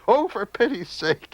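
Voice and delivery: high, loud exasperated cry. A narrator's reading. Mad brother fed up with the peas, high and loud. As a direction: throw up your hands at the peas. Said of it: "he says".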